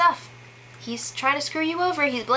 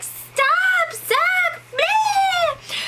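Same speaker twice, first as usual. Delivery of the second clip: much higher shrieking mock yell; imitating her yell; much slower than usual. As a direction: mimic her shrill pleading yell at Zach. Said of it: "Her yelling voice is just like".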